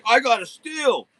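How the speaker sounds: high, gleeful buyer crowing; mock delight. Buyers bragging after the resale, mock delighted. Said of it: "You'll be like".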